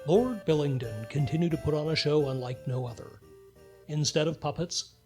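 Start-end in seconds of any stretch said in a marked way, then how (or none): none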